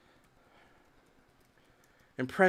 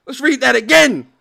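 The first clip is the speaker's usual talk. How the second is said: hyped shout; 6 semitones higher, 10 dB louder than usual. Real, not acted. Loud hype.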